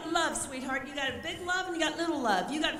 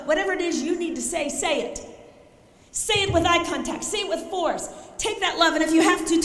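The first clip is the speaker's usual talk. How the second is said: ordinary talk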